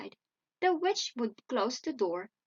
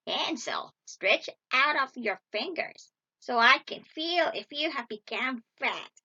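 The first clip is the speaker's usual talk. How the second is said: put-on crone voice; old witch calling; 4 dB louder than usual. A narrator's reading. Witch checks on Hansel in the stable, crone's demand. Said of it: "the old woman crept into the stable and cried".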